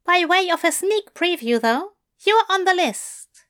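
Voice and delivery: a prim bookish angel confessing lightly; Aziraphale voice. Acted. Aziraphale teasing.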